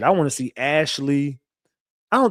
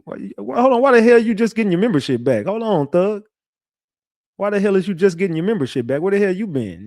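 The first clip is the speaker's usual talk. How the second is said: higher, louder, fast mock indignation; mock outrage; 7 semitones higher, 5 dB louder than usual. Scolding Mary Jane for rejoining late, in mock outrage. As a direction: playfully demand where she's been.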